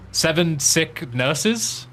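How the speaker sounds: "Seven sick nurses" drawn out very slowly and rising like a question; slow unsure recall. Shown as a demonstration. Gamer trying to recall a Swedish tongue twister, slowly. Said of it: "I don't remember what the actual text was".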